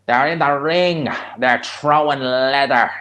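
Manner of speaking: mock a rough Ballymun commentator, loud and drawn out; imitation rough Dublin fight commentary; rough Dublin accent. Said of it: "it's a rough accent".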